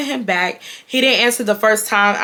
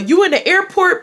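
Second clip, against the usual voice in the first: higher-pitched mocking question; mimicked nosy question; 9 semitones higher than usual. Ex quizzing her about the airport, mimicked nosy question. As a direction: imitate his probing question, pitched up.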